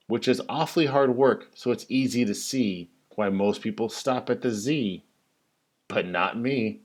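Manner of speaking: usual speaking voice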